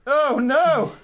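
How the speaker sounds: high, loud "oh no"; startled yelp as it hits; startled cry. Real, not acted. Drone crashes into his face.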